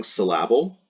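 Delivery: "syllable" stressed on the middle, sil-LAB-ul; deliberately misstressed "syllable"; wrong-syllable stress. Shown as a demonstration. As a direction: put the weight on the LAB of "syllable". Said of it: "it sounds kind of off and weird".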